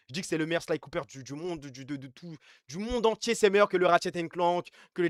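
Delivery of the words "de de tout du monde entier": normal talking voice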